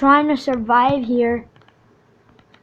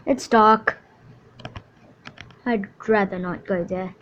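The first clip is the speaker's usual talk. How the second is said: ordinary talk, nothing marked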